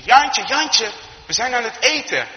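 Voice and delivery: loud, high call through a house. Acted. Farmer's wife calling the boy through the house, calling out. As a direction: summon a child to dinner from afar. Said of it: "ze roept nog een beetje door het huis".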